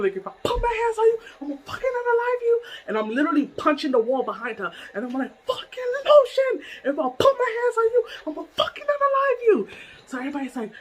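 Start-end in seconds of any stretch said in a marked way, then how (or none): 0.2-2.6 fast, high-pitched angry threat; retold furious threat. Retelling threats at Lotion, high frantic anger. 5.5-9.7 high, strained angry yell; retold furious threat. Retelling punching the wall behind Lotion, enraged squeal.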